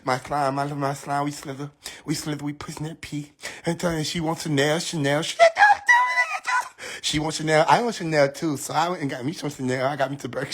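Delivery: soft low lisping mumble-rap; mock lisping rapper. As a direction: lisp through the lyrics lazily. Mocking lisping rapper lyrics.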